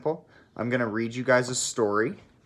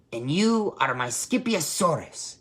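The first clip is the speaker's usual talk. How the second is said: put-on kitty boy voice; 5 semitones higher than usual. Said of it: "he added out loud".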